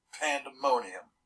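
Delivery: ordinary delivery, nothing marked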